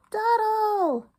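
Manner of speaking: high loud hen squawk; indignant hen squawk. Acted. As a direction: squawk his name like a hen. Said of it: "Henny squawked".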